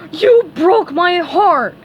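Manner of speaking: high, loud, very slow accusation; hurt anger. A narrator's reading. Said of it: "Patrick said, waving a fist".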